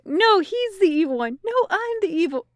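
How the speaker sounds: high-pitched quick bickering imitation; hammed-up indignant denial; mock squabble. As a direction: act out two rivals protesting over each other. Mimics two Crowleys squabbling.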